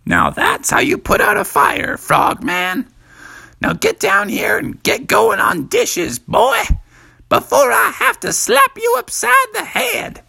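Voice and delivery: very high, loud mother giving orders; a mom sending her boy to the dishes; high, loud, commanding mother; bossy mom. A narrator's reading. Mom after extinguishing fire.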